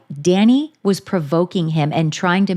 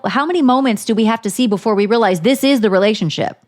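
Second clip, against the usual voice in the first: ordinary talk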